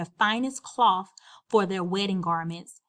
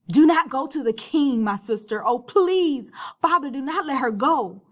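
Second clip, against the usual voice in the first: high, loud frantic begging; sobbing hysterical plea; hysterical pleading; 5 semitones higher, 6 dB louder than usual. Acted. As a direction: plead wildly with your sister. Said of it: "she cried hysterically".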